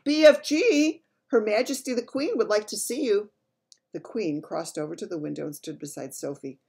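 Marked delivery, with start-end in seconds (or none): 0.1-3.3 high, loud call. A narrator's reading. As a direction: call to someone out in the garden. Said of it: "called out".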